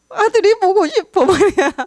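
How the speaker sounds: high, whiny crying plea, half-acted; imitating her own sobbing, pitched high; mock tearful wail. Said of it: "제가 막 울었어요". (울먹이며 높게 흉내 낸 하소연)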